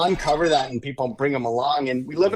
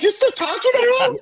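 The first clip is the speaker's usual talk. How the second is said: very high squeal of disbelief; hammed-up impression of an astonished wife; mock incredulous wife; faster than usual. Wife questions husband's late-night call, mock incredulous squeal.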